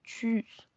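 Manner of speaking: tschüss with a rounded ü, set apart from the Spanish around it; German tschüss. Shown as a demonstration. Teacher demonstrating tschüss to Spanish speakers. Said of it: "como Grüße".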